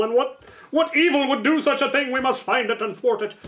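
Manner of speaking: a hint of a grand crusader's call; mock heroic. Player mocking the quest hook, mock heroic.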